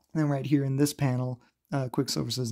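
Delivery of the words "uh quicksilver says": ordinary delivery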